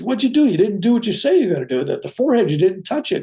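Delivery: high, fast, accusing wife; confronting the surgeon. Retold or imitated. Wife confronts the surgeon, high and indignant. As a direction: demand answers from the surgeon.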